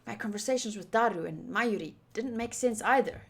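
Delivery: ordinary delivery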